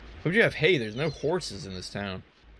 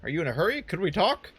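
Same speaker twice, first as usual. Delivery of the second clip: NPC voice; 6 semitones higher, 4 dB louder than usual. Acted. Player voicing NPC dialogue.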